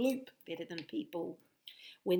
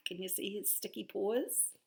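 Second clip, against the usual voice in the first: plain reading voice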